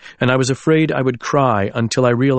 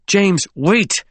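urgent call; 8 semitones higher than usual. Acted. Trying to stop his friend.

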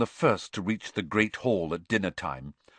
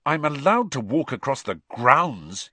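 higher, pointed insistence; 6 semitones higher, 4 dB louder than usual. A narrator's reading. Harry answering Hermione's glance, pointedly. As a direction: make the point firmly, pitch lifted. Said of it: "he said pointedly".

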